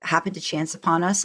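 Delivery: ordinary talk, nothing marked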